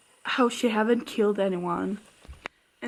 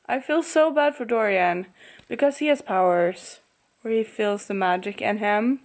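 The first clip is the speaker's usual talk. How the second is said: normal talking voice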